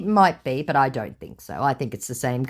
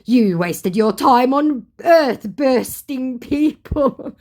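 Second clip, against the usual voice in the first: very slow, high, loud put-on voice; mock scammer accusation; 9 semitones higher, 5 dB louder, much slower than usual. Scammer condemning her for wasting her time, slow mock outrage. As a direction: draw out the verdict, hammed-up.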